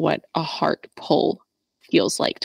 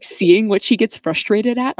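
usual speaking voice

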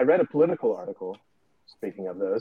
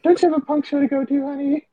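high, quick, loud teasing voice; mock spouse nagging; 6 dB louder than usual. Spouse shooing partner off to a punk show, mock nagging. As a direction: play a fed-up spouse, high and fast.

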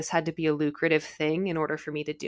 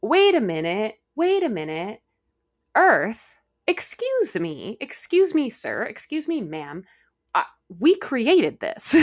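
high, loud mock protest to Earth; politely but loudly interrupt Earth; playful excuse-me voice; soul speaking up; 4 semitones higher, 4 dB louder, slower than usual. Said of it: "the soul can be like".

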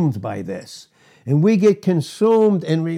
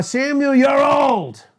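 mock shout; 8 semitones higher, slower than usual. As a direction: call it out high, hamming up the complaint. Acting out the elders confronting Samuel.

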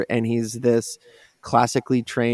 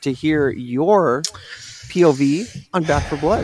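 news report voice; 4 semitones higher than usual. Acted. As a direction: announce it like a TV news anchor. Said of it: "Why, why did I sound like a news, news report?".

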